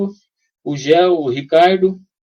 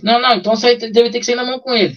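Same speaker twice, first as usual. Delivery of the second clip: quick mock reply; 7 semitones higher, faster than usual. Friend's quote retold.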